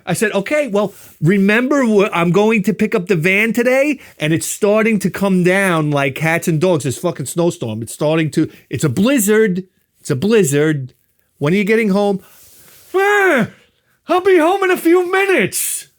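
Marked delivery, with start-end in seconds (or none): 0.3-12.2 acted exasperation. Husband pressing wife on phone. 12.9-15.9 very high, loud nagging-wife caricature; mock wife screech. Wife answering husband's call, hammed-up screech. Acts wife snapping back.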